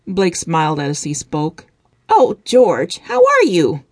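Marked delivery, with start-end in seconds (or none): 2.1-3.8 high, bright friendly greeting; mother sweetly greeting her son's assistant. A narrator's reading. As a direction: greet the guest warmly. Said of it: "greeted him in a friendly tone".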